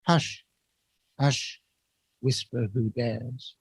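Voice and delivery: "Hush, hush" each set apart by a silent beat, then "whisper who dares" slow and soft; pauses after hush. Shown as a demonstration. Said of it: "So it's quite clear when you read it".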